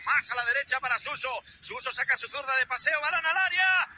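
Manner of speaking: quick radio match narration building to a goal; fast play-by-play. Real, not acted. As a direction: rattle off the passes, then burst out.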